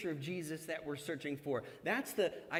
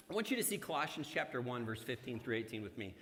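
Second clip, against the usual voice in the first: ordinary delivery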